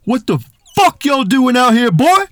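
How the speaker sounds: high, loud threatening yell out a car window; mock street-tough challenge; menacing gangbanger shout. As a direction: bark it like you're about to jump them. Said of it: "one of 'em leans out the window and goes".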